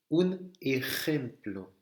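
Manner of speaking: slow EJEMPLO with a rasped throaty J; jota gutturale. Shown as a demonstration. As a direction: stretch out ejemplo, scraping the J. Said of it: "Le J". (J espagnol raclé, lentement)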